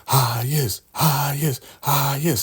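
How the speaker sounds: high, chanting praise; imitated crowd adoration. Followers greeting the Highest as he's carried in, adoring. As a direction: act out a delighted crowd. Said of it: "they're all clapping".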